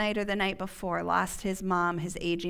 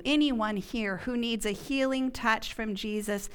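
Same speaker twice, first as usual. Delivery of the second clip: ordinary delivery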